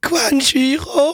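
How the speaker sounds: Crunchyroll squeaked high and slow, voice breaking; cracking voice. Real, not acted. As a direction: stretch Crunchyroll out on a cracking falsetto. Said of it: "Oh Gott!".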